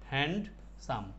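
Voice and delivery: HAND, pause, SAM, slowly; split syllables. Shown as a demonstration. Teacher breaking handsome into two syllables for students, split slowly. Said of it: "মানে দুই ভাঙ্গেই পড়তো".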